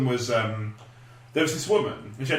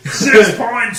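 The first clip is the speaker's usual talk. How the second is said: loud, high, hammed-up Austrian-Swedish accent; Swedish Chef as Arnie; 8 semitones higher, 7 dB louder than usual. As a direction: shout it as an action-hero cartoon chef. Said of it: "Swedish Chef as Arnie".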